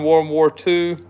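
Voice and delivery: normal talking voice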